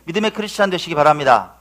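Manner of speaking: loud, rousing pulpit delivery; preaching exhortation. Real, not acted. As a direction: urge the congregation on with force. Preacher exhorts congregation at force.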